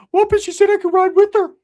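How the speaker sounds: very high, loud, odd voice; cousin's put-on voice. Cousin wanting to ride with her, high loud squawk. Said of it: "Ricky's like".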